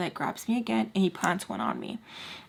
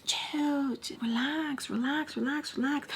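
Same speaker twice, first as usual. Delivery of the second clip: higher, softer mocking chill relax; mocking his calming; 7 semitones higher, 6 dB quieter, slower than usual. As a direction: parrot him soothing everyone, higher and softer. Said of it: "telling everybody".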